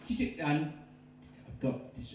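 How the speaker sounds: plain reading voice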